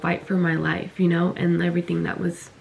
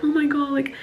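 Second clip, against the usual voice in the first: higher-pitched mock pleading; mimicking the girl; 6 semitones higher than usual. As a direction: lightly imitate her in a raised voice.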